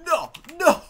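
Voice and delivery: high loud giddy panic; scared giddiness. Real, not acted. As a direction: shriek it in giddy fright. Scared laughing panic in game.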